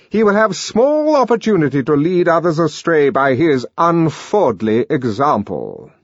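a stern director addressing the crowd; pompous director denouncing. A narrator's reading. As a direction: condemn him before the whole room. Director's speech continues.

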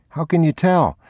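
put-on high child's voice; curious little boy, pitched high; young boy. A narrator's reading. Boy asking his father about the rain, high childlike voice.

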